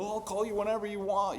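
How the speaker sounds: high, loud people-pleaser voice; gushing mock eagerness, high and loud; over-eager pushover.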